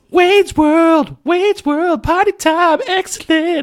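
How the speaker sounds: high, loud party-show chant; Wayne. Acted. High schooler opening morning announcements, loud high-pitched chant. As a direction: belt out the show's catchphrase intro. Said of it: "as Wayne from Wayne's World".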